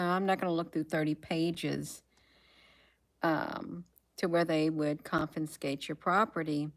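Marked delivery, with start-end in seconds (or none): none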